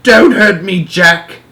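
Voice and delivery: high, frightened giant begging; pleading yell. Acted. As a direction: cry out for mercy. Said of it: "he yelled".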